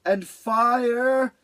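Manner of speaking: high, grumpy question. Acted. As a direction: grumble it, half awake. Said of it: "Beaver sounded grumpled".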